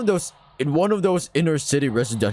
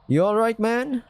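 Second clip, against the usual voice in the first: Gil's voice; 10 semitones higher than usual. Acted. Gil's voice, redone.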